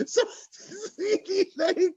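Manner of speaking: chuckling through the words; laughing while talking. Real, not acted. Joking to the audience about a passive aggressive person in Cairo, laughing. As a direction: laugh as you tell the joke.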